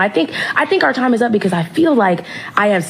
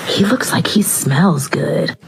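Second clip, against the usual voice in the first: whispering; much slower than usual. Retold or imitated. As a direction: lean in and whisper it. Mom leaning over whispering at concert.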